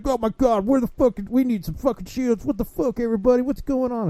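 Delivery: whiny gamer. Retold or imitated. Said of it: "He's like".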